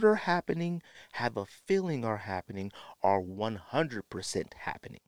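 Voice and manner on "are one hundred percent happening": normal talking voice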